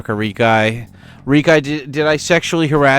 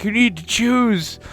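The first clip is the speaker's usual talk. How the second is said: hammed-up hostage taker; 9 semitones higher than usual. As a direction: play the unhinged captor giving an ultimatum. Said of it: "being like".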